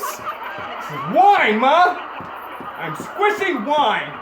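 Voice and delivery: put-on loud, drawn-out, giggling little kid; excited kid. Toddler boasting to his mom, excited giggly shout. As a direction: squeal it like a proud toddler.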